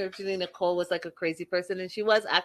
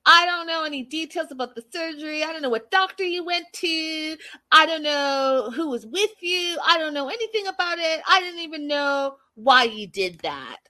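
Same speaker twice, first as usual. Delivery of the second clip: mock petulant boyfriend; 7 semitones higher than usual. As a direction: whine each grievance like a sulking boyfriend. Boyfriend's acted quarrel.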